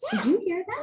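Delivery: ordinary delivery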